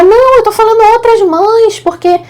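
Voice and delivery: high, excusing mock voice of the lady; imitating the lady. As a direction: pitch her up as she explains herself away. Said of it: "Aí ela, ela". (imitação aguda da senhora se justificando)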